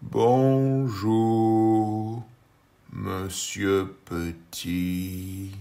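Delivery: slow, drawn-out worm greeting; earthworm voice. Acted. Worm greets Monsieur Petit, slow drawn voice. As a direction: stretch monsieur out lazily.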